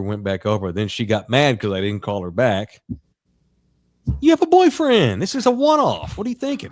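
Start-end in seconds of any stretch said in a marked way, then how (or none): 4.2-6.7 very high, incredulous acted-out objection; hammed-up exasperated pleading; mock indignant protest. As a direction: protest way up high, starting loud. Acting out his protest to her.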